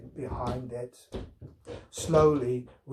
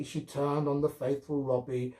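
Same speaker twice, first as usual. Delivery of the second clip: ordinary delivery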